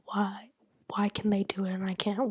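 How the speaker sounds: ordinary delivery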